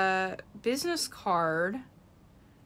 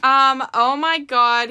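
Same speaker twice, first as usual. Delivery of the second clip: excited gasp; 4 semitones higher, 10 dB louder, slower than usual. Real, not acted. Opening the box, seeing the doll.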